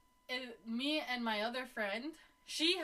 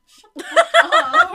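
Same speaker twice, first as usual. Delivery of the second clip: much higher, much louder laughing shout; laughing shriek; 24 dB louder, slower than usual. Real, not acted. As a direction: scream it through laughter. Laughing outburst at the squeaky chair.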